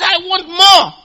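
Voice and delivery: loud, high plea. Retold or imitated. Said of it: "He shouted the louder".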